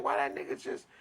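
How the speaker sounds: high breathy unfinished question; stunned whisper. Retold or imitated. Friend said peace, high whispered confusion. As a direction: breathe it out, baffled and hurt. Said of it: "I'm like".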